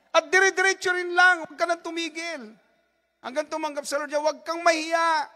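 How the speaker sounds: loud, high, fervent preaching; preaching at full force. Acted. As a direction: rouse the congregation to share. Preaching to congregation. (masigasig na pangangaral nang malakas)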